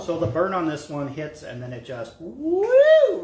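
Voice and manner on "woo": high loud whoop; excited whoop. Real, not acted. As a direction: let out a big burning woo. Reacting to hot sauce burn.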